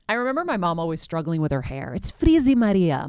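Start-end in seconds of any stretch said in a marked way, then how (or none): 1.9-3.1 put-on high, loud Greek mom accent. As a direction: do her mother's Greek accent, pitched up. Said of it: "my mom would say in her Greek accent".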